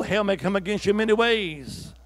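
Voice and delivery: ordinary talk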